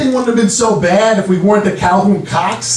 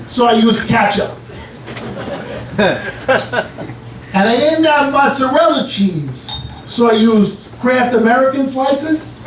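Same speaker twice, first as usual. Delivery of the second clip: put-on old dad voice; 6 semitones higher, slower than usual. Dad explains his pizza.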